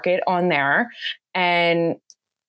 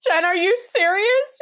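high, amazed voice of a bride; 14 semitones higher than usual. Retold or imitated. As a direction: play the stunned, grateful bride. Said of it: "So she's like".

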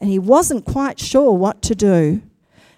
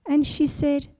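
ordinary delivery, nothing marked

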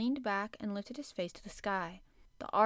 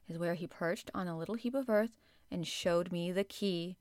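ordinary delivery, nothing marked

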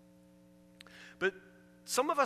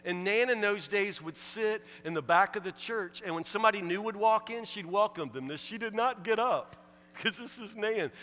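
ordinary talk

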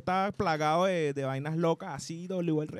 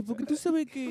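put-on Peruvian accent; 8 semitones higher, 5 dB quieter, slower than usual. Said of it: "Es que él es peruano".

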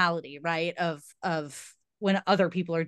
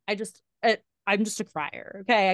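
usual speaking voice

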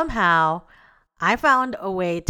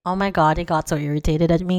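usual speaking voice

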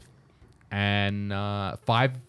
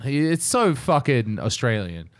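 normal talking voice

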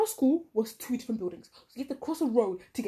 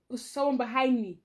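normal talking voice

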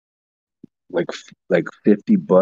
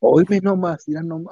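put-on Mexican Spanish lilt, pitched up; playful Mexican Spanish accent as a joke; 9 semitones higher than usual. Friend jokingly slips into a Mexican Spanish accent. Mock Mexican Spanish phrase, then "just kidding.

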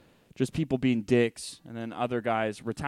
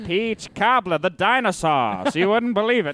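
put-on louder old-timey Mid-Atlantic barker; Mid-Atlantic carnival barker pitch; Mid-Atlantic accent; 4 semitones higher, 5 dB louder than usual. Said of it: "best Mid-Atlantic impression".

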